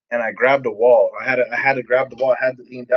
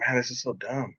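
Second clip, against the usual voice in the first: sullen muttering; 12 dB quieter than usual. Retold or imitated. Resident grumbling to himself about the programme, muttered. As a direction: mutter it much softer, fed up.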